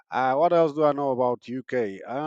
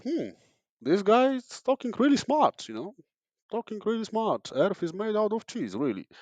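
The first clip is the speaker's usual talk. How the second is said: mock-impressed, pitched well above usual; high-pitched musing, as if taken in by nonsense; impressed listeners; 7 semitones higher than usual. Comedian voicing people fooled by a British accent, high, impressed musing. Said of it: "people are like".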